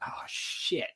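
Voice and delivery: whispered, breathless dread; hushed oh shit. Retold or imitated. Rival realizing he's in trouble, whispered dread. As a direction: breathe it out under your breath. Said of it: "He's like".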